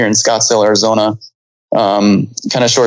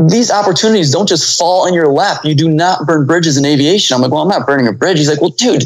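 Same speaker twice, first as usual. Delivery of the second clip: lightly voiced scolding instructor; 6 semitones higher than usual. Instructor scolds about the card.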